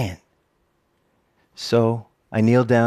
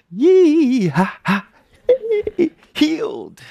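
overcome with joy; 3 dB louder, much slower than usual. Real, not acted. Overcome recalling the healing.

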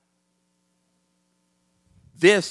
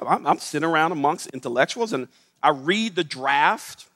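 normal talking voice

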